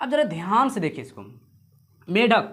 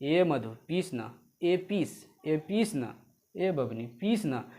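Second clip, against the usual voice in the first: coaxing husband; 5 semitones lower, 7 dB quieter than usual. Acted. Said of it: "तो बोला".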